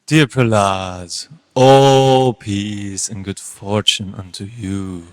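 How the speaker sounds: very low, slow, drawn-out blessing; low, stretched-out words; unctuous teacher. Acted. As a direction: pour out a slow, deep-voiced benediction on your pupil. Teacher greets Prahlad.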